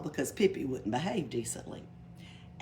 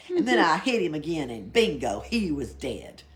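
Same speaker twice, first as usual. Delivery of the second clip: much louder boast; loud triumphant bingo; 8 dB louder than usual. Acted. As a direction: crow it like a winner. Hitting snake again.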